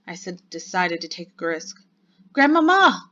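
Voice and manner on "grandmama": high, full-voiced call; loud shout. A narrator's reading. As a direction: shout for grandmother through a closed door. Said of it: "I shouted as loudly as I possibly could".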